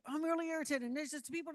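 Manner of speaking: high, loud agitated rant; irritated. Shown as a demonstration. Trainer showing an irritated guest before calming the tone, agitated and loud. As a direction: act out someone worked up and irritated. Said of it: "you're gonna control the tone".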